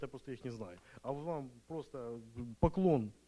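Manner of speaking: normal talking voice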